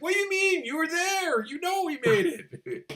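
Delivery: high-pitched, loud protest; indignant mock shout. Creator answering someone who asked who it was stolen from, indignant. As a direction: shout back in exaggerated disbelief. Acted indignant reply to accusation.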